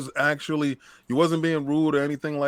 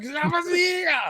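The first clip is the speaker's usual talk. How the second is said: high dramatic yell like a dubbed Japanese movie; Japanese movie style; slower than usual. Retold or imitated. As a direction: shout the name like a film hero. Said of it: "like a Japanese movie".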